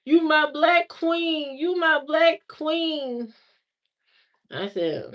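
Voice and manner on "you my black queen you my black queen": high, slow, drawn-out mock yell; retold phone yelling. Ex calling her on the phone, high slow mock yell. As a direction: ham up his slow serenading holler. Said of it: "He was screaming on the phone".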